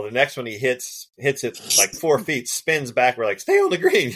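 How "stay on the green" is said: high pleading call to the ball; half-laughing plea at a rolling golf ball. Retold or imitated. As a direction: beg the ball to stop, up high.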